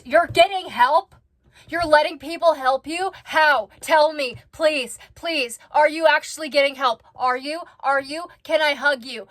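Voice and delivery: high, loud thrilled questions; pressing her excitedly for the news; excited disbelief. Real, not acted. Reacting to her getting help, loud and overjoyed.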